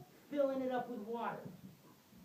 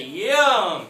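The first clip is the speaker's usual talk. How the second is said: loud yum; 16 dB louder than usual. Shown as a demonstration. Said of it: "Everyone say".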